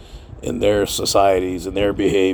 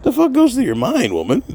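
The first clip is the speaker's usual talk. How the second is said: incredulous retort. Retold or imitated. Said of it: "I'm like".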